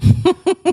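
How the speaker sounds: big high laugh, loud and real; loud burst of laughter. Real, not acted. A loud real laugh at his realtor's advice.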